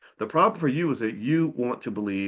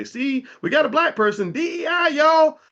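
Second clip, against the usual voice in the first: much higher and louder, a hammed-up proud announcement; mocking boastful employer; 7 dB louder than usual. Host mimicking employers bragging about a hire, hammed-up and boastful. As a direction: show off the token hire, mock-triumphant. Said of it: "they'll say".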